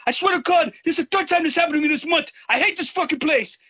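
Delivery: exasperated rant. Retold or imitated. Nagia fed up after disarming the gunman, high fast rant. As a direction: vent in a high exasperated rush.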